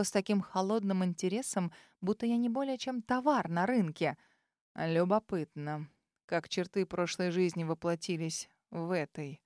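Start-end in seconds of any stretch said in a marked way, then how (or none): 4.7-9.4 low cold musing of a dragon; Ralfair's cold interest. A narrator's reading. Ralfair speaks, voiced low.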